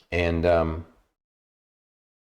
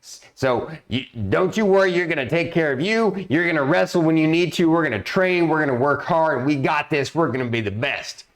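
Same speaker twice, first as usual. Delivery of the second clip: motivational drill sergeant; 7 semitones higher than usual. Retold or imitated. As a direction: fire up the squad, coach-style. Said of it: "this crazy kind of motivational speaker drill sergeant combo".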